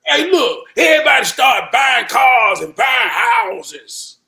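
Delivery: booming, drawn-out declaration; loud emphatic. Real, not acted. Telling his cohost about coworkers buying houses, booming. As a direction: shout it out slowly. Heated storytelling, shouting.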